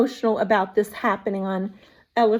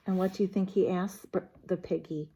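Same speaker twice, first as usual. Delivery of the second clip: ordinary delivery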